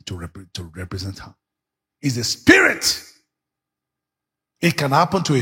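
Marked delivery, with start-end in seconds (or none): none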